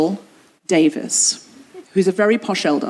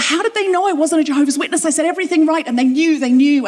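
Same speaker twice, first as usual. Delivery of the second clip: dawning disbelief; 8 semitones higher than usual. Retold or imitated. Retold inner realization.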